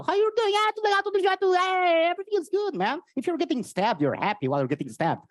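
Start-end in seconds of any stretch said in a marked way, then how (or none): 0.0-3.0 high, loud, upbeat Brazilian voice; cheerful Brazilian. Retold or imitated. Comic imitates a relentlessly happy Brazilian, loud and upbeat. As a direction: beam like everything's great. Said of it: "In Brazil, no".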